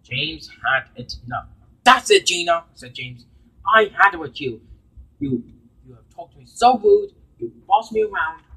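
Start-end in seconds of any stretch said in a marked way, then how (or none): 1.8-8.4 louder, fed-up engine telling her off; angry outburst. Acted. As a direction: let the anger burst out. James finally loses his temper.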